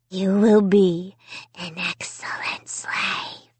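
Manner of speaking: slow, menacing whisper. A narrator's reading. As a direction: breathe it into her ear. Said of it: "he whispered in my ear".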